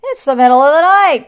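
growled. A narrator's reading. Lion scolds the singing Hornbill, angry growl. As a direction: bellow it crossly at the noisy bird. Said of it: "growled the lion".

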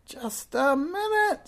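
very high, loud, drawn-out screech; piercing witch's shriek; scream. A narrator's reading. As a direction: scream it slowly to halt them. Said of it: "screamed Madame Snickesnee".

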